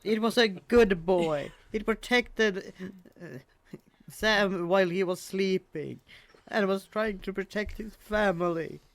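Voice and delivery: loud, high plea for the dog; impassioned defence. Real, not acted. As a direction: protest it with rising indignation. Mock-outraged defence of the dog.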